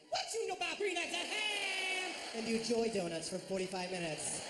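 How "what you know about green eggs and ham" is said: high, slow crow with a long last word; gleeful mimicry, pitched way up; imitated exuberant holler. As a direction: crow it out, dragging HAM. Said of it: "just be like".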